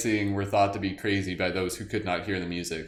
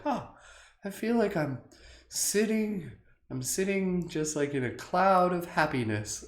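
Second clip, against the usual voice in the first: dreamy inner voice; 9 semitones higher, 3 dB quieter than usual. Retold or imitated. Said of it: "It's just like".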